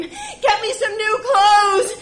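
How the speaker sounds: high, loud slow shriek; a desperate wail; screaming demand. Retold or imitated. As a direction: scream it across the house. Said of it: "scamper and scream".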